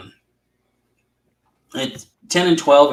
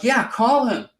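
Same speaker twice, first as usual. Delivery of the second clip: high, eager quote; retold eager urging; 10 semitones higher than usual. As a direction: act out friends egging him on. Retelling group urging the call.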